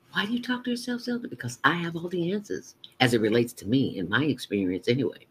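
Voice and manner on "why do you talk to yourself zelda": higher curious question to herself; a hint of a squeaky inner voice; lightly voiced inner self. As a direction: poke at yourself playfully. Asks herself in another voice.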